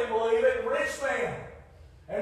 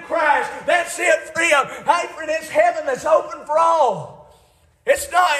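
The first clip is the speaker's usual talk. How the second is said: full-force preaching; 4 semitones higher than usual. Acted. Preacher exhorting the congregation, full-force preaching.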